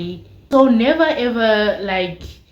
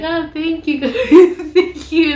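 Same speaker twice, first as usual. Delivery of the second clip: laughing awkward thanks; 8 semitones higher, 5 dB louder than usual. Retold or imitated. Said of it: "sitting there like".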